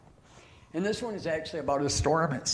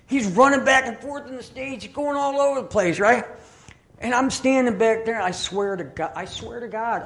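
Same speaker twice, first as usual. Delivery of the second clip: raised, louder storytelling; excited disbelief; 8 semitones higher, 8 dB louder than usual. Real, not acted. Preacher swearing he watched the pastor go wild, amazed. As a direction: keep it high and amazed.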